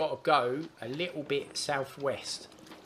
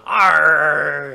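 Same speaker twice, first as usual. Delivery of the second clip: booming pirate bellow; louder pirate arr; 13 dB louder than usual. Pretend. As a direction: belt out the pirate cry.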